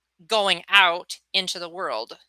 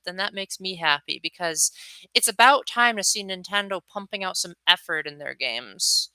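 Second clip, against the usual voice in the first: ordinary talk, nothing marked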